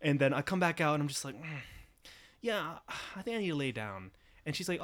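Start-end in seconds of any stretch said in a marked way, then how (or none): 1.4-4.1 weak, soft sick voice; faking frailty. Pretend. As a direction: sound too sick to stand. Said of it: "pretending to be sick".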